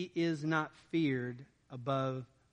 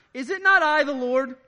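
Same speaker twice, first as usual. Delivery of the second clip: high, loud divine challenge; God rebuking Moses, loud and raised; booming God; 7 semitones higher, 9 dB louder than usual. Acted. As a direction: thunder it as God. God's rhetorical challenge voiced.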